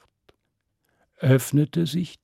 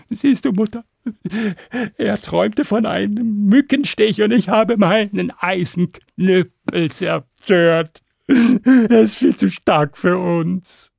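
high, louder, panicky dragon son; frightened dragon son; 7 semitones higher, 5 dB louder than usual. Acted. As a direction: whine in alarm to his mother.

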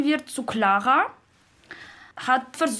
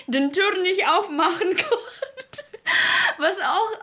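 words pushed out through real giggles, pitched higher; laughing while talking; 8 semitones higher than usual. Real, not acted. Learner retelling Heidi's door trouble, laughing through the words. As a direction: laugh as you tell the funny door moment.

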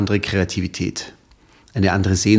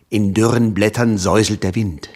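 dramatic poem recital. Shown as a demonstration. Guest reciting his favourite poem on air, dramatic. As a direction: recite the ballad with grave suspense. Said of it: "Jetzt soll ich was aufsagen?".

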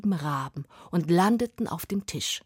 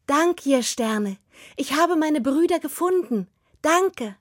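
put-on girl thanking; 9 semitones higher, 4 dB louder than usual. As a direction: thank the stars joyfully.

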